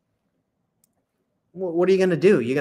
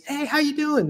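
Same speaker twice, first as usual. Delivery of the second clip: much higher, bright friendly hello; smiling upbeat greeting; 10 semitones higher than usual. Retold or imitated. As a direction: greet them with a big smile. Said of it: "They're smiling".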